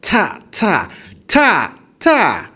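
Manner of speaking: ta said high and level, repeated; high-tone practice. Shown as a demonstration. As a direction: drill ta on a high flat tone. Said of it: "I'm not pronouncing it".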